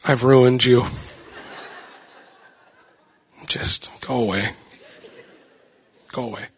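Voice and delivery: low, defeated delivery; sinking, mock-despairing voice; dejected dad. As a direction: say it slumped with regret. Dejected dad to his copycat daughter.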